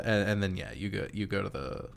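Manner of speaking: ordinary talk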